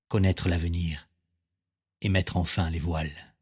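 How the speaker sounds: measured, intimate poetry reading with long pauses; poem recitation. Acted. Poet talking to himself to find calm, measured recitation. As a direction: recite it line by line, letting each settle.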